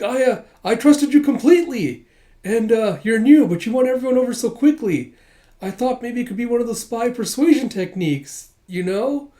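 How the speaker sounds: high, loud earnest stammering; hammed-up innocent victim; flustered pleading. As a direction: explain yourself, hurt and frantic. Retold flustered pleading excuse.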